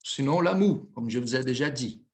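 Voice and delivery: usual speaking voice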